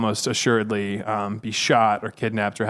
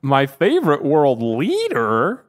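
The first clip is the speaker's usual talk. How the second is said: higher and slow, put-on drawl; Gary Johnson impression; 4 semitones higher, 5 dB louder, slower than usual. Candidate stalling on live TV, mimicked in a slow put-on voice. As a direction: stretch it out in his odd voice. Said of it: "that's what he sounds like".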